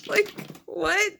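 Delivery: high, breathless laugh of disbelief. Real, not acted. Laughing at her own purchase.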